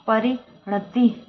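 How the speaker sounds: ordinary talk, nothing marked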